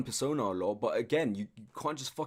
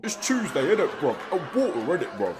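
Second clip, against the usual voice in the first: mock British accent; 3 semitones higher, 4 dB louder than usual. Mocking his whole comedy aspect, hammed-up British accent. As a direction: parody British slang with a raised voice. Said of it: "just imitating the British accent".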